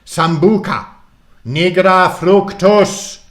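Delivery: booming high-pitched wizard's spell; full-force theatrical conjuring, slow and loud. A narrator's reading. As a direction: hurl out the spell, stretching the last word. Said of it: "Murden continued much louder".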